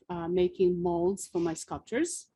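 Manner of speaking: normal talking voice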